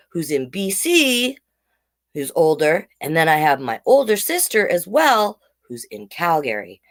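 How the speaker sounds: ordinary delivery, nothing marked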